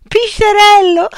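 Pisciarello! burst out laughing and drawn out; Pisciarello! cried high and loud in mocking glee; laughing outburst. A narrator's reading. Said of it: "sbottò Altamarea, cominciando a ridere".

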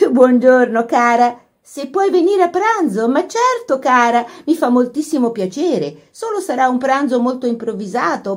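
put-on high, animated girlish chatter; excited phone chatter. A narrator's reading. Laura inviting Kitty to lunch by telephone, excited. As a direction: greet a dear friend with delight.

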